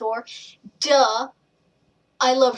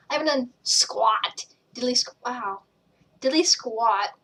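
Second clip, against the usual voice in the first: usual speaking voice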